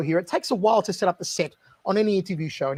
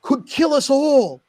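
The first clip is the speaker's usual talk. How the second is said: mock-dire warning, louder toward the end; Steven Seagal; 6 semitones higher, 4 dB louder than usual. Star warning the producer of dangerous things, intense imitation.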